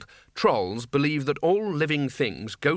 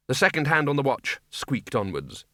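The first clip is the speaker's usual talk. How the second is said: usual speaking voice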